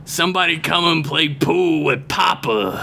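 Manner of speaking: put-on lightly slurred drunk; a hint of sloppy drunk; drunk guy voice. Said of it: "I like your drunk guy voice".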